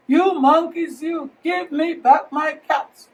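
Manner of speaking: angry. Acted. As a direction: shake both hands and yell. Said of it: "This made the peddler angry".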